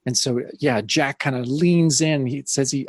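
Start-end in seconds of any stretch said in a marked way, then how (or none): none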